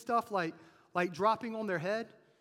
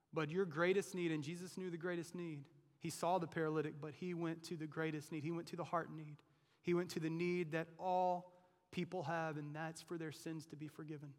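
ordinary talk, nothing marked

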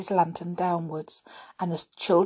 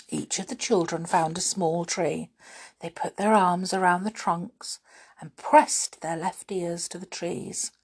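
ordinary delivery, nothing marked

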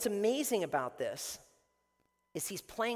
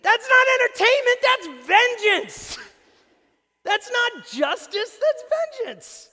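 very high, loud fiery preaching, slowed on vengeance; preaching at full force; 14 semitones higher, 10 dB louder than usual. Acted. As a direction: thunder it from the pulpit.

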